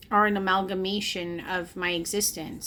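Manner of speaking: ordinary talk, nothing marked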